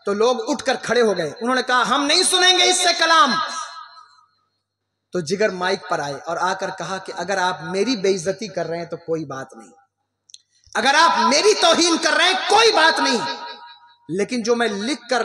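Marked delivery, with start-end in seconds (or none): 1.9-3.3 raised, high voice of refusal; indignant protest from the crowd, imitated; acted crowd protest. 10.7-13.4 high, raised declaration; poet's answer, declaimed from the stage; emphatic retold reply. Poet answers hostile crowd, retold emphatically.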